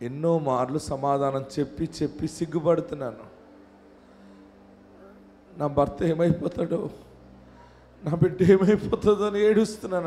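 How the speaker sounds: low, soft pastoral voice, long pauses; hushed prayer leading. Real, not acted. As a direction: gently draw a praying congregation inward. Leads congregation into hushed prayer. (మెల్లగా, తగ్గు స్వరంలో ప్రార్థన)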